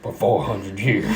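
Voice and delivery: low, slow, hushed speech; hammy quiet solemnity; solemn movie character. Acted. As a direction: drop down soft and slow. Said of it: "he's like".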